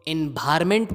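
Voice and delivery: एनवायरनमेंट as in-BHAAR-ment; b in place of v in एनवायरनमेंट; three-syllable एनवायरनमेंट, in-bhar-ment; very wrong pronunciation. Shown as a demonstration. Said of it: "बिल्कुल ही गलत है".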